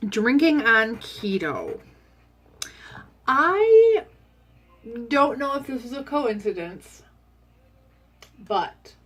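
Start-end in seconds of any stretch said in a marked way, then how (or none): 3.3-7.0 speaking through a yawn; stretched, yawning words. Real, not acted. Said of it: "why am I yawning?".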